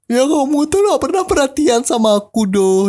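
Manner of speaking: high whining imitation of a crying girlfriend; mock crying girlfriend. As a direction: play the weepy girlfriend complaining. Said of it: "dia nangis gitu bilangnya".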